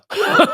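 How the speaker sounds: short high burst of laughter; a quick high giggle; bright laugh. Real, not acted. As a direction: laugh at yourself, high and loud. Laughs after own line.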